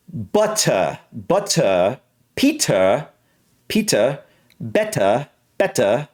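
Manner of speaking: British crisp T, open vowel ending; British accent. Shown as a demonstration. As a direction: hit the T and drop the R, British style. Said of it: "but the T is exaggerated".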